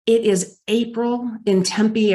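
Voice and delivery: normal talking voice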